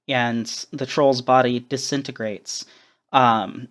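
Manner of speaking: ordinary delivery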